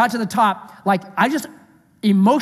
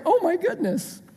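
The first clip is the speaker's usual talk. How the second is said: higher, lightly imitated stunned parent; mock dismay; 9 semitones higher, 4 dB quieter than usual. Parent reacting to a child's huge request, mock dismay. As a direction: act out a floored parent's reaction.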